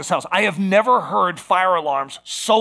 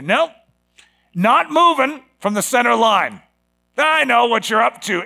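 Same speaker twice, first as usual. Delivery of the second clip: higher-pitched defiant refusal; strong, defiant faith; 4 semitones higher, 4 dB louder than usual. Acted. Believer refusing the enemy's bluff, strong and defiant. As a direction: stand firm and refuse to budge. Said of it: "hold that faith up strong and say".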